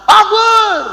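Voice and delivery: high, loud frightening shout of takbir; frightening intonation. Shown as a demonstration. Preacher showing listeners how takbir frightens people, frightening shout. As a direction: roar the takbir to scare them. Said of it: "intonasinya itu menakutkan".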